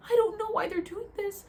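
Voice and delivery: high, tearful pleading apology; imitating tearful pleading. Apologising to friends in her vision, high tearful pleading. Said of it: "That's what I kept saying".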